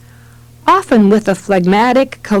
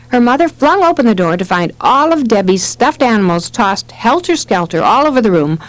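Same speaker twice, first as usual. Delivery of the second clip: ordinary talk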